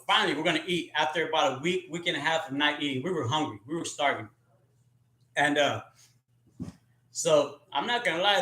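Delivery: normal talking voice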